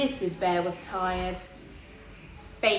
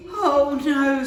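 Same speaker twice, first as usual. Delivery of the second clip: loud groaning oh no; exasperated Mister Bear; 4 semitones higher, 9 dB louder than usual. Acted. Mister Bear in the garden, loud exasperated groan.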